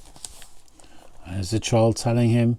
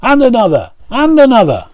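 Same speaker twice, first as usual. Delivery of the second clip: high, loud rising glee; excited; 12 semitones higher, 7 dB louder, faster than usual. A narrator's reading. Charlie yelps at each new fish, high and loud.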